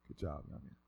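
put-on very soft, low old man; hushed, low imitated compliment; old man's praise. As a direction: quietly murmur approval as an elder.

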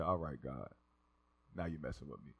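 very low, soft grumble; hushed, dropped-voice complaint; muttered disbelief. Retold or imitated. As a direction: mutter a protest under the breath. Muttered protest to God.

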